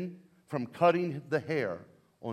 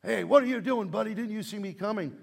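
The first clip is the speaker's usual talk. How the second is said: pitched up, a mildly shouted complaint at a stranger; mock yell; 6 semitones higher than usual. A driver yells at the pastor in a parking lot, mock angry shout. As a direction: play the honking driver, half-hearted yell. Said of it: "and he yells at me".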